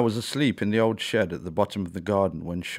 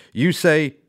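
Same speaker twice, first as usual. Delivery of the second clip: slow, loud, higher answering shout; shouted loudly; 4 semitones higher, 7 dB louder, slower than usual. A narrator's reading. Ella shouting back the answer to the owl's chant. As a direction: shout the reply, drawn out. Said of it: "Ella shouted loudly".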